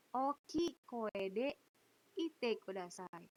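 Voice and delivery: slow, deliberate classroom request. Shown as a demonstration. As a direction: spell out the request slowly for students. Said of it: "saya selalu bilang seperti ini".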